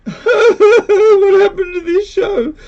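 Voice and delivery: loud, high, drawn-out wail; slow tearful howl, played for laughs; mock crying. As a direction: bawl it in hammed-up despair. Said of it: "we were crying too".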